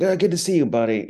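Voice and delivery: mock-chummy greeting; fake-friendly greeting. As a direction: schmooze like you barely know them. Imitating the same fake-friendly man.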